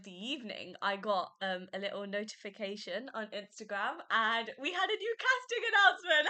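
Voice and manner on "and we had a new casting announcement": laughing through the words; giggly, excited delivery. Real, not acted.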